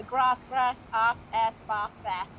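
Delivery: "Grass", "ass", "bath": first long AH, then short A; alternating regional vowels. Shown as a demonstration. Joking about Yorkshireman dad, "Grass", "ass", "bath" in alternating long and short A. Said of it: "'cause my dad's Yorkshireman".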